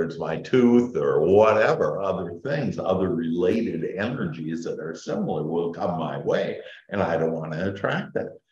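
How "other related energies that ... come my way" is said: ordinary delivery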